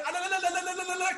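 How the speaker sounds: high-pitched rapid "la la la" trill; wild ululating cry. Pretend. Comedian pretends firing an AK in the air, wild ululation. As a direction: trill it high like mock celebratory whooping.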